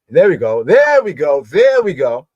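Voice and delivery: loud, swooping "there we go" called out; rising jubilantly in pitch as you repeat it; pleased outburst, big pitch arcs; excited exclamation. Real, not acted. Finds the word on screen, calls out.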